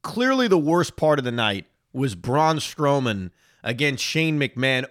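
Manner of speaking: ordinary talk, nothing marked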